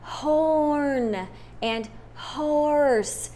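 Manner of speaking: horn and horse drawn out very slowly. Shown as a demonstration.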